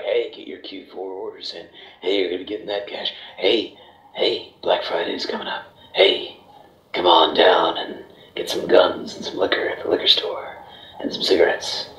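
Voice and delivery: low, breathy ad pitchman going creepy; half-whispered dark sales voice; deep voice. Pretend. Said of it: "my voice got deep".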